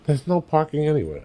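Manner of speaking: lightly mimicked panic; panicky wife. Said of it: "my wife is like the queen of panic".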